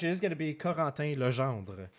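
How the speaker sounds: Corentin Legendre in a French Canadian accent, nasal French vowels. Shown as a demonstration. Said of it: "with my French Canadian accent".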